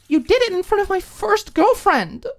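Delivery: very high, loud outburst; angry roommate yelling at Alicia. Acted. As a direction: hurl it at her. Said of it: "Maud started to yell".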